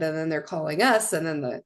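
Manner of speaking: normal talking voice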